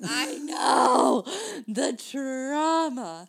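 mock-dramatic wail, stretched out at the end; hammed-up dismay. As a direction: lament it with over-the-top drama. Hammed-up reaction to show ending.

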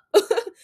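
a loud, high burst of laughter. Real, not acted. Podcaster laughing at her own outburst, loud and high.

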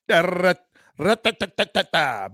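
rapid da-ra-ta-ta gun noises; mock gunfire. Retelling how the guest came in, mock gunfire. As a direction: rattle off a mouth machine gun. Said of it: "she came in".

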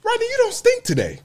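high-pitched mock schoolgirl voice; retold schoolgirl. Middle-school girl to a smelly classmate, high-pitched backhanded compliment.

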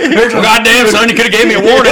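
high, loud mock trucker complaint; hammed-up outraged trucker holler; aggrieved trucker. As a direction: yell it like a busted trucker. Voices the pulled-over trucker.

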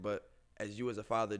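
normal talking voice

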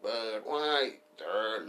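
Budweiser, stretched very slow; stretched mock ad call. Repeating the old ad, Budweiser stretched very slow.

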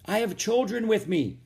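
high-pitched distant call. A narrator's reading. Rose tells the woman about the children, calling out. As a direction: send the words across the water. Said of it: "Rose called".

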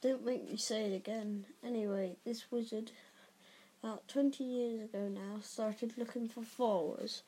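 low, soft gentle giant telling a dark history; hushed, rustic storyteller; Hagrid, grave and uneasy. A narrator's reading. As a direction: recount it reluctantly, quietly.

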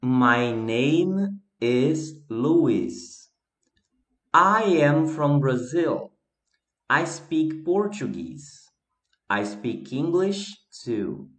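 slow, careful English reading for learners; deliberate classroom read-aloud. Shown as a demonstration. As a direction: read it out slowly and clearly like a teacher. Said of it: "Leia e responda".

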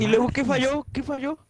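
high, loud eager question; high-pitched, eager to hear what went wrong; excited. Real, not acted. Amigo pregunta emocionado qué falló, high and eager.

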